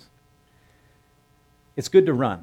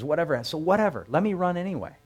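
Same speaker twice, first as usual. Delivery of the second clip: pleading eager youth; 8 semitones higher than usual. Retold or imitated. Ahimaaz begs to run.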